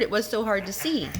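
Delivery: plain reading voice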